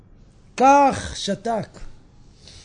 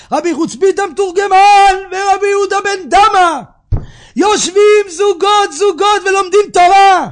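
preaching, building; 3 semitones higher, 7 dB louder than usual. Acted. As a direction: roll out the names in a rising, ever louder chant-like list. Preaches, building to full force. (דרשה נלהבת, הולכת ומתגברת)

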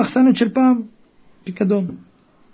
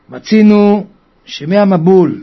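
usual speaking voice

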